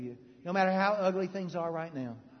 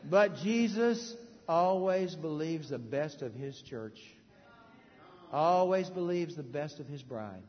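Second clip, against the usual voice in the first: tender and slow; slower than usual. Real, not acted. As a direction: say it gently with love. Tender point about the church.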